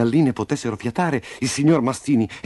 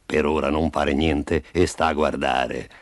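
low, even owl voice; wise owl; 7 semitones lower than usual. Acted. Owl advising the little rooster, low and calm.

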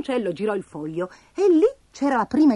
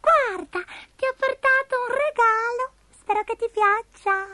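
very high, slow cartoon voice; tiny giggly creature offering a gift; Abigail the little spider; slower than usual. A narrator's reading. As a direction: squeak it slowly, way up high. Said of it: "Abigail ridacchiò".

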